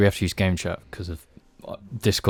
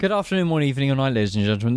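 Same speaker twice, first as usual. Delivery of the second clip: announcer voice; 4 semitones higher, faster than usual. Acted. Mock formal podcast welcome.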